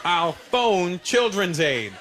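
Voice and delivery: slow, spaced-out threat; slow deliberate bluff. Retold or imitated. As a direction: spell it out slowly to dad. Kid repeats bluff.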